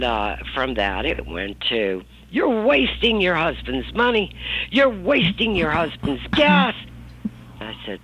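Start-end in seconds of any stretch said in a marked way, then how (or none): 2.3-6.7 mother nagging. Retold or imitated. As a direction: scold her for wasting money. Mother scolding in the car.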